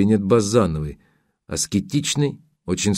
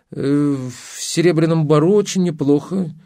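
unsure; 7 semitones higher than usual. A narrator's reading. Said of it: "неуверенно проговорила Изабель".

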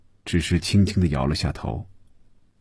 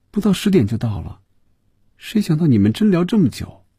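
put-on higher-pitched girl's voice; girl's reply; 10 semitones higher than usual. A narrator's reading. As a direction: answer high, with a touch of complaint. Girl answers in acted dialogue.

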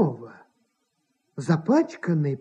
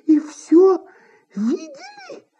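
halting, high-pitched disbelief; appalled actress, voice climbing high; aghast; 14 semitones higher, slower than usual. A narrator's reading. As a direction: gasp out the question in horror. Actress horrified at being seen.

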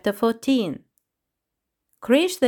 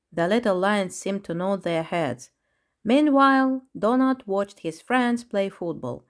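usual speaking voice

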